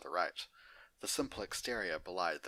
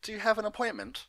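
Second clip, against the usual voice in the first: higher, louder and quick; brisk front-desk pony, pitched up; receptionist voice; 8 dB louder, faster than usual. Acted. Receptionist asking Big Mac, high brisk voice.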